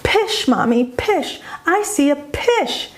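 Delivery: put-on high, excited little-kid voice saying "pish" for fish; small child's high, eager call; child mispronouncing fish. Shown as a demonstration. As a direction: pipe it up like a toddler with a p for f. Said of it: "if a child is saying something like".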